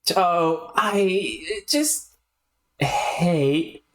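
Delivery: slow, dragged, halting hatred; understated self-loathing; self-hating rant. Acted. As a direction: drag the words out in misery.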